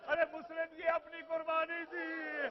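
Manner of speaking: a mother's loud lament from inside the tent; widow's cry. Acted. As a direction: cry out as a grieving widow offering her son.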